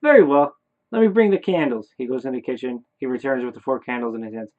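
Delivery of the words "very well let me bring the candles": hammed-up high, loud neighbour; loud, obliging, pitched up; Bob. Neighbour goes to fetch candles, exaggerated high loud voice. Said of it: "what Bob would say in my head".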